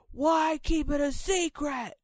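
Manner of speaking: shouted high and slow; reading bold text. Acted. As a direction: bellow the bold words. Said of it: "It's all bold".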